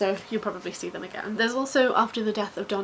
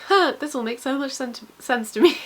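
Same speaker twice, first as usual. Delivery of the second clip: dawning realisation; 4 semitones higher, 6 dB louder than usual. Retold or imitated. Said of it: "and being like".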